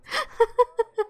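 high-pitched amused giggle; real laugh. Real, not acted. Player amused by Sandy's jealous outburst, giggling. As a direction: burst into a giggle at the drama.

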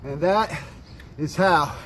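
panting, short gasped phrases; winded after climbing the hill; out of breath. Real, not acted. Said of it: "Let me catch my breath".

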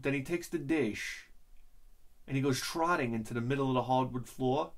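ordinary delivery

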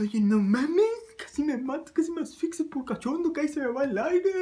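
high-pitched whining protest; mock complaint. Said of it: "le dije".

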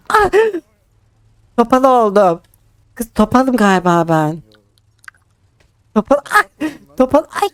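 groaning from a hurt leg; in pain. Real, not acted. Limping after being hit by car.

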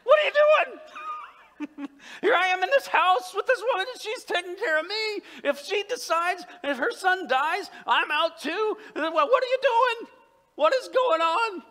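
hammed-up, high-pitched yelling at God; falling apart. Elijah alone protesting to God, loudly falling apart. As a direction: cry out in full-voiced, exasperated panic. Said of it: "and then he falls apart".

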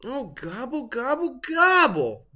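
high gobbles building to a loud wail; wailing turkey. A narrator's reading. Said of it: "wailed Turkey".

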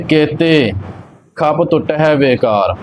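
ਕੇਤੇ, a clear break, then the rest; pause after ਕੇਤੇ. Shown as a demonstration. Teacher showing where to rest, pause after ਕੇਤੇ. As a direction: stop briefly right after ਕੇਤੇ. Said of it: "ਕੇਤੇ ਤੇ ਵਿਸ਼ਰਾਮ ਲੱਗਣਾ ਹੈ".

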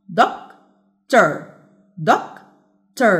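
doctor split as doc, pause, tor; syllable by syllable. Shown as a demonstration. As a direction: break doctor into two separate beats. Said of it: "يبقى كام syllable". (مقطّعة إلى مقطعين بينهما سكتة)

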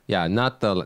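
ordinary delivery

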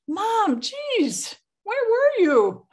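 high exasperated where were you; exasperated scold. Retold or imitated. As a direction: demand an explanation from mom. Scolding mom on the phone.

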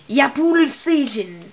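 Yapul Seijin said slowly and loudly in a Japanese-style accent; Japanese-style pronunciation. Shown as a demonstration. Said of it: "say it like a Japanese person".

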